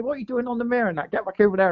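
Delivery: a touch of a pushy bailiff's nag; Ben the bailiff nagging. Retold or imitated. Bailiff Ben texts the angler to move swims, mock nagging.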